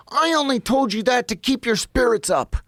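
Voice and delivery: put-on a cranky old lawyer brushing off his client; old man's retort. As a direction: toss it off like a grumpy old man. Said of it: "mean old man".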